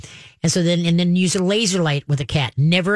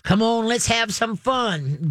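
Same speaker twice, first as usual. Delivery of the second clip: higher, slowed-down coaxing to play; playful invitation; 6 semitones higher, slower than usual. Retold or imitated. Trainer mimicking an owner revving up the dog, playful and coaxing. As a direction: wind the dog up like an eager owner. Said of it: "It's a".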